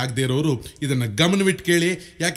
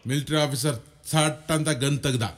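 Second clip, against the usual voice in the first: ordinary talk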